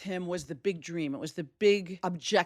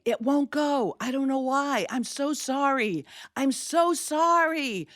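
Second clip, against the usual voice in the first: higher, pleading, slowing on the last sorry; flustered sorry-sorry to the honking crowd; panicked apology; 7 semitones higher, slower than usual. Retold or imitated. To furious New Yorkers in the tunnel, panicked apology. Stalled car, apologizing to angry drivers.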